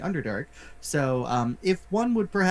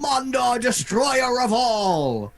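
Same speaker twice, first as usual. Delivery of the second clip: slow, booming heroic echo; epic proclamation; 7 semitones higher, 4 dB louder, much slower than usual. Acted. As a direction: repeat the title grandly. Echoing the epic title.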